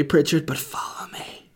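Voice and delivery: 1950s Hollywood whisper; mock whisper. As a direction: whisper it like an old movie lover. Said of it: "nineteen fifties Hollywood love story".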